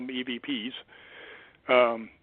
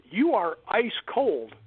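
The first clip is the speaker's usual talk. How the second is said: slow, higher-pitched amazed quote; retold astonishment; 6 semitones higher, much slower than usual. Girl touching his arm, slow mock amazement. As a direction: draw out her astonishment.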